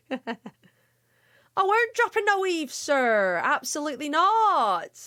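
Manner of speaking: drawn-out, hammed-up West Country denial; Samwise Gamgee. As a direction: protest like a rustic West Country servant. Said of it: "my best Samwise Gamgee".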